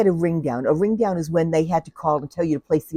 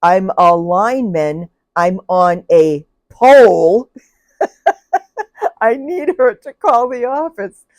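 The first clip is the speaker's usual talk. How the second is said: loud, slow shouting over the line; shouting lineman; 3 semitones higher, 9 dB louder, slower than usual. Retold or imitated. Lineman on a pole shouting to the supervisor. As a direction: bellow it down a bad line. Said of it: "And the guy goes".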